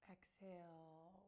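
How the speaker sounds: long, drawn-out soft exhale; getting into it. Real, not acted. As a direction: stretch the word out slowly, sinking into the breath. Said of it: "Got into it, sorry".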